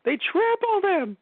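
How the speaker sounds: high, slow mock horror; mock horrified shriek.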